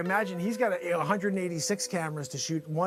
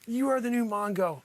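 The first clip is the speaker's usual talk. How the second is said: ordinary talk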